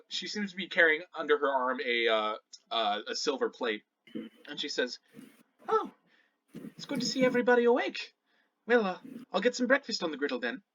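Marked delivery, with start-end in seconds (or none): 5.7-10.6 quick, higher hostess voice; lightly voiced Shannara, welcoming. Shannara greeting everyone at breakfast time, welcoming. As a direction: greet the sleepers briskly, pitched up.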